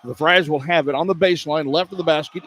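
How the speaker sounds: usual speaking voice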